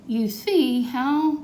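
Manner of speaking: slow, high moaning plea; moaned. Acted. Grandpa pleading over lost teeth, slow mock moan. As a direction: drag it out like a sorry old man. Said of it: "moaned Grandpa".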